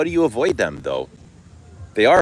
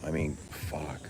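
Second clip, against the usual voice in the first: much softer breathy aside under the breath; whispered curse; 2 semitones lower, 11 dB quieter than usual. Real, not acted. Perfume reviewer quietly cursing in awe of the scent, whispered. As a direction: let it slip out as a whisper to yourself.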